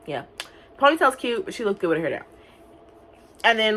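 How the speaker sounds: ordinary talk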